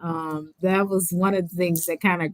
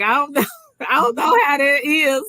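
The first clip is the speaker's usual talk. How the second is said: laughing; 7 semitones higher, 5 dB louder than usual. Retold or imitated. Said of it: "I'm like".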